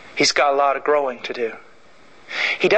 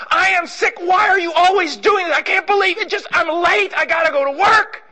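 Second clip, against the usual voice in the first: very high shouted rant; angry yell; 14 semitones higher, 3 dB louder than usual. Retold or imitated. Husband yelling at his wife before work, high and shouting. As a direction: shriek it at her, fed up.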